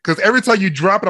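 usual speaking voice

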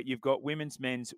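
ordinary delivery

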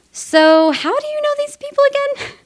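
very high, disbelieving question; incredulous. Retold or imitated. She asks the guy about the mansion owners, very high and disbelieving. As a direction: squeak of alarm.

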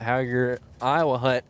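ordinary delivery

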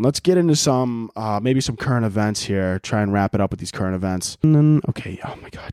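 normal talking voice

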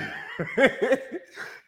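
loud high laugh breaking in; high laughing burst. Real, not acted. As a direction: crack up mid-impression. Laughs during his impression.

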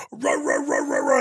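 very low, growled wordless grumbling; imitated angry ranting. Men kicking the door in, imitated angry roaring. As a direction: growl out mock shouting as gibberish.

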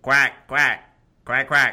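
loud duck quacks; animal chorus. Pretend. Calling out Oregon Ducks.

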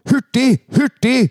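high, drawn-out coach's call; Trond Henriksen calling out. Retold or imitated. Co-commentator quotes coach's frequent saying, imitated urging call. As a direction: urge players on, imitating a coach. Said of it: "du siterte Trond Henriksen".

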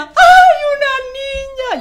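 very high and loud joyful cry; tearful cry of joy. Retold or imitated. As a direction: burst out at the news of a girl. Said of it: "Llorando, yo a mares". (grito de alegría entre lágrimas, muy agudo)

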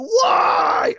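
very high, loud scream; anguished scream. Retold or imitated. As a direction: scream it in grief and rage. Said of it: "he's screaming".

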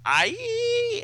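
high, drawn-out cry of pain; high mock shriek; comic-book scream. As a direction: let out a long hammed-up "aiee".

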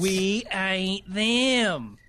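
very slow drawl. Acted. Repeated catchphrase.

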